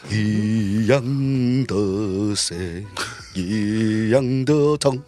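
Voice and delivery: put-on slow Teochew-accented Mandarin; teochew dialect. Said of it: "[name]是有一点点带有那种潮汕口音".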